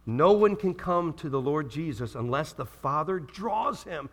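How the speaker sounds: usual speaking voice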